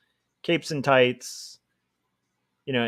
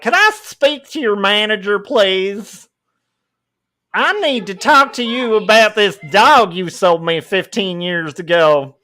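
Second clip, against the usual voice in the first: higher, louder entitled customer voice; mock Karen; 5 semitones higher, 5 dB louder than usual. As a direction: demand the manager, haughtily. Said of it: "She's like".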